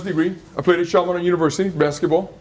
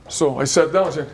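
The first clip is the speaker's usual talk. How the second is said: usual speaking voice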